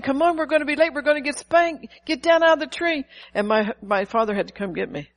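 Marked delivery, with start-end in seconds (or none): 0.0-3.0 high, louder mock pleading call up a tree; impatient brothers calling. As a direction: hurry her down, exasperated. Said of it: "My brothers kept saying".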